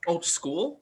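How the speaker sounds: ordinary delivery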